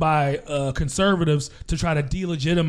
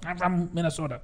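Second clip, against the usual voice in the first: put-on Minnesota accent; 8 dB quieter than usual. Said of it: "Do another one".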